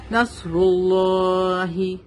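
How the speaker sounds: نصر اللہ slowly, vowel of اللہ held; stretched one alif. Shown as a demonstration. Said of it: "اس کو ایک الف کے برابر کھینچیں گے". (نصر اللہ کو کھینچ کر)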